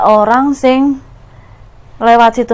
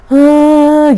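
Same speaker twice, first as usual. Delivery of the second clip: a loud, higher sobbing cry; louder crying gasp; 5 semitones higher, 5 dB louder than usual. Retold or imitated. Ghost crying outside the window, now loud sob. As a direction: mimic the woman's crying growing louder. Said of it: "semakin keras gitu loh suaranya".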